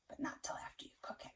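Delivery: put-on whispered aside to the kids. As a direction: share it like a little secret. Secret aside to children.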